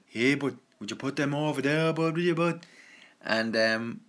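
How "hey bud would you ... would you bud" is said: put-on a touch higher, a Dublin "bud" accent. Showing how Dubliners talk, Dublin accent.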